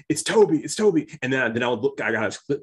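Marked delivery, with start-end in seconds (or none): 0.1-1.1 high, louder excited call; imitating a thrilled fan; excited whisper-shout. Cousin in the theater recognizing Toby, excited. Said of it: "my cousin says".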